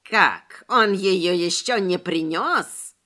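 loud, slow, outraged rattle; indignant skeleton. A narrator's reading. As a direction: cut in, scandalised. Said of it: "перебил его скелет".

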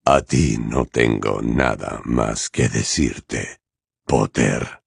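low, slow, impassive voice; unperturbed villain. A narrator's reading. Voldemort done talking with Potter, unperturbed. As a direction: speak deep and unhurried, wholly unmoved. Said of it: "dijo sin inmutarse".

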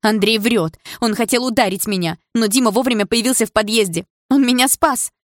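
high, loud accusation; urgent shouting. A narrator's reading. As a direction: cry out the truth to convince mother. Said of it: "закричала я". (дочь криком убеждает маму)

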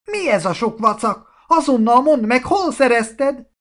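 high, sharp astonished demand, slowing at the end; a stunned mother demanding answers. A narrator's reading. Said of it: "a csodálkozástól földbe gyökerezett a lába".

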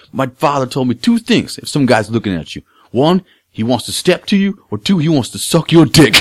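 high, mock-shouted stranger's rant; imitated screaming. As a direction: build into a hostile proclamation. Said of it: "he's screaming to some other guy".

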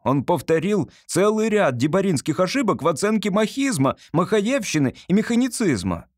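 put-on high-pitched boy accuser; denouncing son. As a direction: list the errors like a zealous little prosecutor. Narrator voices the denouncing son.

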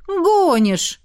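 high, drawn-out disbelief; no-way exclamation; incredulous. A narrator's reading. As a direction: scoff in astonishment, voice jumping up. Sister disbelieves brother's news.

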